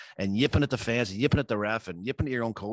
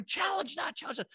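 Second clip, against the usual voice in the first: mock urgent demand; 6 dB quieter than usual. Player runs to his coach demanding a challenge, high mock urgency. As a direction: imitate a player pestering for a challenge, voice pitched up.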